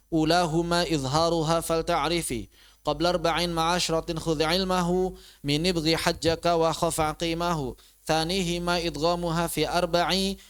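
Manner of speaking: measured Arabic poem recitation; verse recitation. Real, not acted. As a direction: recite the verses in an even, metrical rhythm.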